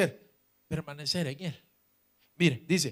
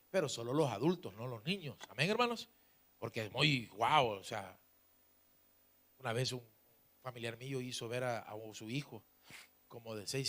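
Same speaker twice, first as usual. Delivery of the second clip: ordinary talk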